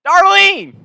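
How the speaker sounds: very high, loud scream; mock holler. Prank caller yells for his wife, mock holler. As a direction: holler to someone in another room.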